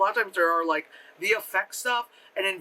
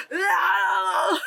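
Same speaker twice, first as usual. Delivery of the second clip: very high, drawn-out wail; mock yelling; 11 semitones higher, 4 dB louder than usual. Imitating an actress's yelled singing, mock wail. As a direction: belt it as a crude holler. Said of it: "Why are you yelling?".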